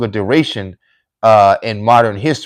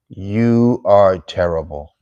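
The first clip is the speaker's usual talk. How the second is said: ordinary delivery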